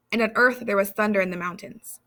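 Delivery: ordinary delivery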